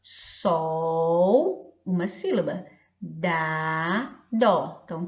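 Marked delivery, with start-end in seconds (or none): 0.0-1.8 SOL drawn out as one long syllable. Shown as a demonstration. Teacher showing children the syllables, SOL slow and separate. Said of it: "ela tem três sílabas". 3.0-4.8 DÁ and DÓ as separate beats; syllable by syllable. Shown as a demonstration. Teacher counting syllables for children, Dá and dó separated. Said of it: "ela tem três sílabas".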